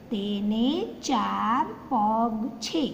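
slow, careful sentence reading; teacher reads slowly; slow reading aloud. Shown as a demonstration. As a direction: spell it out slowly for children. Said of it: "ए आया, देखा?".

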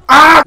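very loud, high "ah"; short full-voiced scream; startled scream. Real, not acted. Player crashing with his horse, startled scream.

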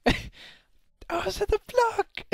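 slow, high wail breaking into laughter; slow laughing cry of injustice; laughing drawn-out wail. Real, not acted. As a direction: stretch the protest out while laughing. Repeats protest, drawn out laughing.

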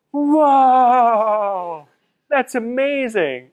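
long, loud, high "whoa" of amazement; amazed. Retold or imitated. Buddies in the US seeing him on CNN, acted amazement. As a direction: draw out the awe, high and slow.

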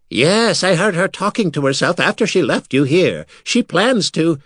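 high, urgent talking skull. Acted. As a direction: press it like a worried skull. Skull Mira explains.

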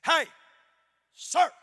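loud bossy TSA agent calling a traveler; authoritative agent. Retold or imitated. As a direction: call him out like a stern TSA agent. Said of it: "hear her get very authoritative".

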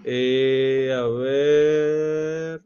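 long, held filler sounds; drawn-out hesitation. Real, not acted. Teacher searches the viewers' comments, stalling with drawn-out words. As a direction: stretch each word while searching.

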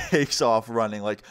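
ordinary talk, nothing marked

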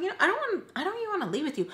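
high-pitched refusal, acted out; retold protest. Acting her refusal in a quarrel.

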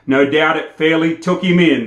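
plain reading voice

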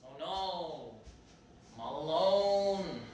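soft, slow and low; mock lonely. Alone without her girlfriend, a soft pitiful sulk.

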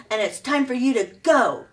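growled. A narrator's reading. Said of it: "growled Thorn".

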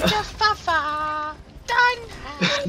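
high, slow silly voice; drawn-out squeaky voice; bad acting. Mocking name in silly voice.

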